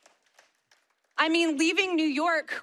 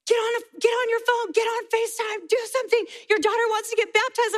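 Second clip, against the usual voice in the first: high-pitched, frantic imitation of excited friends; urgent urging; 9 semitones higher than usual. Friends urging mother to get on FaceTime, urgent urging. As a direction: pile up the demands in a high voice.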